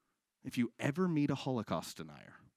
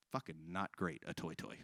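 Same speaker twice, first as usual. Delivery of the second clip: low disgusted mutter; 8 semitones lower, 11 dB quieter than usual. Retold or imitated. Disgust opening toilet door.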